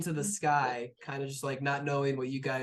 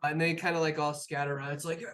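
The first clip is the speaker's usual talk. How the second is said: ordinary delivery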